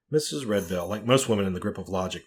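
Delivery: usual speaking voice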